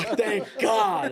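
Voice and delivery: slow, high, laughing relief; laughing relief once the prank is revealed; relieved laugh. Retold or imitated. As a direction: breathe out the words, laughing with relief.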